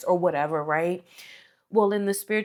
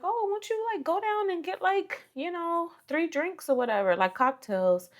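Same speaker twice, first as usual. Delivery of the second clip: mock high voice for the husband; a teasing high imitation of her husband; wife quoting her husband in a silly high voice; 10 semitones higher than usual. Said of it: "my husband was like".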